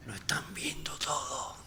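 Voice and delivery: a hushed aside under the breath; whisper. Acted. Jesus whispering to his dead friend. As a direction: whisper it so the crowd can't hear.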